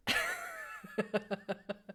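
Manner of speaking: long, amused laugh. Real, not acted. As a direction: let a real laugh run on. Game master amused by German speakers.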